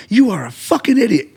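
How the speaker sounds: high-pitched, emphatic mock insult. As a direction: act out an exasperated telling-off. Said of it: "emphatic at the time".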